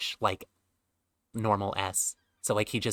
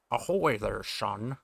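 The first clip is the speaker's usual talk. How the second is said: lightly Scottish sailor; a hint of Sean Connery; slower than usual. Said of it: "this guy's gonna be Sean Connery".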